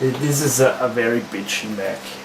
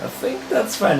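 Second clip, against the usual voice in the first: much higher-pitched "I think that's fine"; insistent reassurance; 11 semitones higher than usual. Real, not acted. Reassuring a friend about the frozen laptop, insistent and high.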